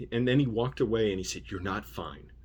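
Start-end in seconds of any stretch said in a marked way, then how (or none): none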